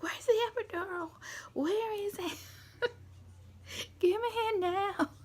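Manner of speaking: high, whiny-angry imitation of impatience; mock angry demand. Patient waiting for the epidural, mock angry demand. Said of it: "so angry at this point".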